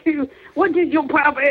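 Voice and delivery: hammed-up French accent; mock French. Podcaster mimicking the French to co-hosts, mock French accent. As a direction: play an indignant French speaker for laughs. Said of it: "that's what the French do".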